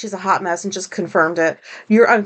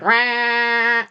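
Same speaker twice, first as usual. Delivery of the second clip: long blaring "rah" of a phone alert; imitated phone alarm. Podcaster mimicking tornado alerts going off on three phones, imitated alarm. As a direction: mimic an alert tone with a drawn-out cry. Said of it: "phones went off with".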